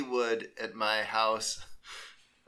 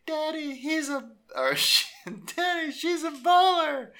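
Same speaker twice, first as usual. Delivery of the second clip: put-on high little-girl voice, much higher; imitating his daughters; 5 dB louder than usual. Father imitating his little daughters praising a player, high child voice. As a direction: squeak it like a young daughter telling Daddy. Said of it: "they're like".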